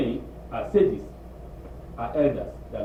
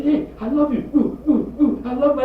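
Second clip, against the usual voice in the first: high, louder, giddy lovestruck voice; mock lovesick swooning; infatuated lover; 10 semitones higher, 5 dB louder than usual. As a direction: gush like a smitten young man. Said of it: "You say".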